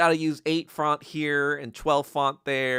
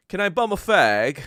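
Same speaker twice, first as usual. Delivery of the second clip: high, loud hammed-up British voice; mock British accent; 9 semitones higher, 10 dB louder than usual. Mocking the British manager's cigarette request, high and loud. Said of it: "he was British".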